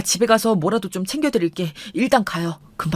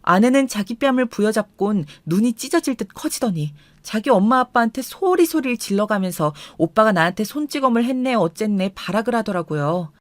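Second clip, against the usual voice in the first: plain reading voice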